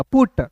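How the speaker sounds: पुट said with a rounded u, not a; right pronunciation. Shown as a demonstration. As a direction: correct पुट, rounded u sound. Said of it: "पट नहीं पढ़िएगा". (पुट को उ से बोलिए)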